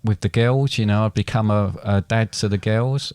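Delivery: ordinary talk, nothing marked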